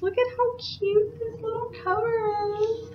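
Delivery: high, soft, tiny squeal; cutesy gushing. Real, not acted. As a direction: coo over something adorable in a small high voice. Gushing over a cute cover.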